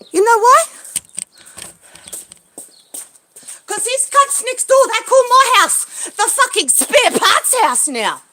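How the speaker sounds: furious outburst; angry shout. Real, not acted. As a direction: yell the accusation. Angry about neighbours.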